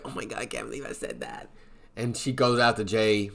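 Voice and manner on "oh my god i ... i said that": much lower, soft regretful voice; regretful murmur. Retold or imitated. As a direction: quietly kick yourself. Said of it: "She's like".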